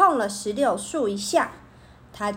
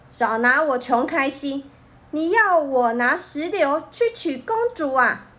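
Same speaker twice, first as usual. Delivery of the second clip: plain reading voice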